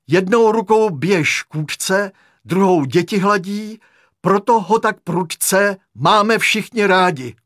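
loud, high, slow declamation; poem recitation. A narrator's reading. Patient reciting a birthday poem to the leader, loud recitation. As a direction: declaim it like a school verse. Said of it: "báseň".

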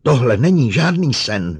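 loud, high outburst; hysterical cry. A narrator's reading. Psychologist cries out against the robot, near hysterical. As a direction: cry it out as hysteria takes hold. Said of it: "vykřikla a cítila, jak se jí zmocňuje hysterie".

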